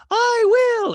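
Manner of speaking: loud, piercingly high impression; sing-song it out in loud falsetto; Dorit. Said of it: "And Dorit's like".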